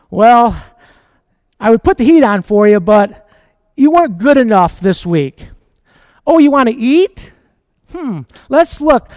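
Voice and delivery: loud, mocking stern parent withholding the heat; sarcastic parent scolding the child, loudly; harsh parent mockery. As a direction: taunt the kid like a cold, loud parent.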